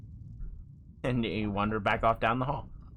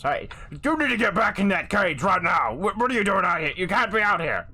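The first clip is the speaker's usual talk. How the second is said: raised, higher-pitched orc scolding; alarmed orc order; 6 semitones higher, 3 dB louder than usual. Acted. Orc guard orders escaped prisoner into cage, alarmed. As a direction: order him back with urgent force.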